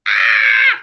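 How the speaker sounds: high, loud startled yelp; sudden yelp. Real, not acted. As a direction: yelp at the slip. Catching a slip mid-word.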